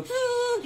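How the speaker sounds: high hummed note, like blowing through a grass reed; mock wheat hum. Showing old chap how to play wheat, high mock hum. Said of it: "You go".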